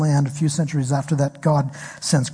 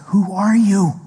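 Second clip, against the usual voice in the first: startled question; 4 semitones higher, 3 dB louder than usual. Retold or imitated. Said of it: "And he says".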